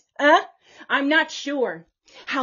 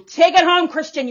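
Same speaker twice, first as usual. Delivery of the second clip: loud scolding; 6 semitones higher, 11 dB louder than usual. Real, not acted. Scolding Christians.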